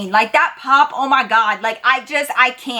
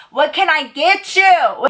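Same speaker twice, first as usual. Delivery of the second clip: higher, slow, drawn-out repeat to be heard; raised voice; 5 semitones higher, slower than usual. Acted. Waitress repeats herself to a guy over loud music, raised voice. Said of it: "the music was loud".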